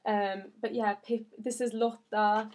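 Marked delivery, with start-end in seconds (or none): none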